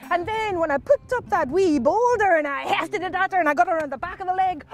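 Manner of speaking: high, energetic boast; gleeful husband. Acted. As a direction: relive the throw with mounting relish. Husband relives hitting her.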